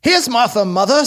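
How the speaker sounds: put-on high, lively young girl; girl's voice. A narrator's reading. Girl telling mother that Martha is here, high girlish voice. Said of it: "said a girl".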